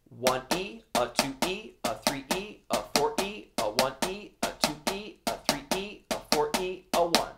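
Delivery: soft steady counting in time with the drums; quiet metronome-like count; counting aloud in rhythm. Shown as a demonstration. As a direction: chant the counts quietly and evenly. Counts rhythm while playing.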